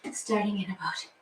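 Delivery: whispered countdown aside; hushed aside to the class. Real, not acted. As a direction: breathe it out unvoiced.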